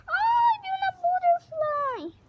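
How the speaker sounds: very high, loud shriek; startled scream. Real, not acted. Scared of a butterfly, high shrieking cry.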